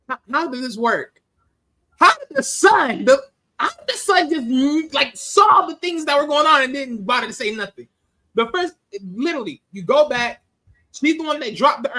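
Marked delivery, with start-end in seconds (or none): 0.1-7.9 disbelieving outcry. Retold or imitated. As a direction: cry out in stunned disbelief at the son. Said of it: "that I'm like".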